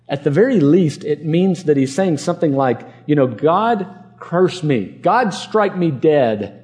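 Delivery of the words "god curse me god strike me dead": very slow, drawn-out curse on oneself; solemn oath. Acted. Peter invoking a curse on himself, slow and solemn. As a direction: weigh each word like swearing an oath.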